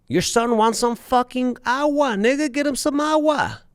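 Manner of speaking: high-pitched, incredulous scolding; exasperated high-voiced heckle; outraged viewer. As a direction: scold him from the audience, pitch way up. Imagined movie viewer scolding him.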